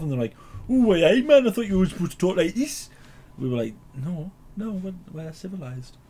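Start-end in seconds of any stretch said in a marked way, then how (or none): 0.7-2.9 high, loud, exaggerated northern twang; mock Geordie accent. Southern campers teasing northerners, hammed-up Geordie accent. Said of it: "told them we were from up north". 3.9-6.0 higher, softer, slowing at the end; reply with dainty, restrained politeness; mock civilized. Retelling a northerner's reply to campers, mock-refined.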